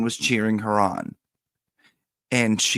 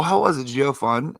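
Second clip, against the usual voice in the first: eager question; 5 semitones higher, 5 dB louder than usual. Retold or imitated. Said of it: "I go".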